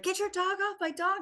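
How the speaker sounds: high, indignant demand; upset dog owner. Retold or imitated. Alpha dog's owner at the dog park, high indignant cry. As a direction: squeal it like the frantic owner. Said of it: "owner was like".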